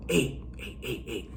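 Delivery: hushed, repeated little calls; furtive whisper. Retold or imitated. Paranoid inmate secretly calling over a friend, furtive whisper. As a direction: get attention without being overheard. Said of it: "why did you whisper right now".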